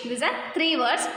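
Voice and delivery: usual speaking voice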